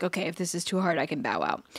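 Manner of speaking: soft, low inner voice; quiet kid's thought. Retold or imitated. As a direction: mutter it like a tempted kid.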